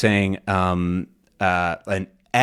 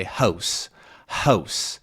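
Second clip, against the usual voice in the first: "hose" for house, Canadian raised vowel; Canadian raising; 2 semitones lower than usual. Shown as a demonstration. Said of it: "they might say".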